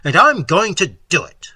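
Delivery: loud, punchy list of threats; fierce, emphatic vow; fierce resolve. A narrator's reading. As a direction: hammer out each step defiantly. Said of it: "said I fiercely".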